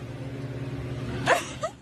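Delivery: high, airy nervous giggle; breathy nervous laugh. Real, not acted. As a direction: giggle out of nerves.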